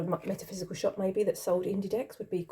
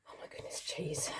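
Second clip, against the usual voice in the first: breathy whispered oh my goodness; a hushed, awed whisper; whispered gasp; 4 semitones lower, 15 dB quieter than usual. Real, not acted. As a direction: whisper it as if overwhelmed. Overwhelmed by the cheese question.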